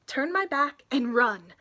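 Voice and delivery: plain reading voice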